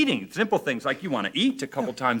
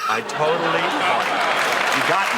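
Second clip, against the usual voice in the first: rueful amused admission; 4 semitones higher, 5 dB louder, slower than usual. Real, not acted. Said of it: "admitting being fooled".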